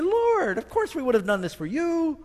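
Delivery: as if shocked at the accusation; protesting surprise. Acted. The lost protesting to the Lord, a high startled cry.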